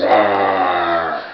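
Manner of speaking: put-on loud playful lion roar for the baby. Parent roaring like the lion for baby. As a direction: growl it out like a big cat. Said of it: "What does the kitty say?".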